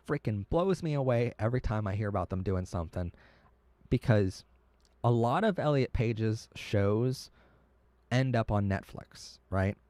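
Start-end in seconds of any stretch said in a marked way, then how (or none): none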